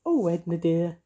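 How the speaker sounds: high, sugary cooing witch. Acted. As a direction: sweet-talk the wicked witch. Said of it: "cooed Mavis".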